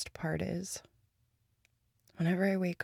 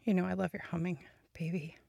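ordinary delivery

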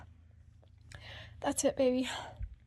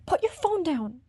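normal talking voice

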